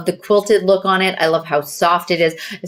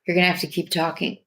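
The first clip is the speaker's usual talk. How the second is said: choking on water; 6 dB quieter, faster than usual. Real, not acted. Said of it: "My water went down the wrong way".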